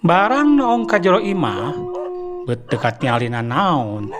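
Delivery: plain reading voice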